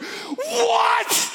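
high shouted what; exploding shout. Retold or imitated. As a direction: blow up at the kids. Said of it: "And I just exploded".

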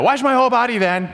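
hammed-up dramatic demand. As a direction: overdo it theatrically. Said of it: "you know, the dramatic".

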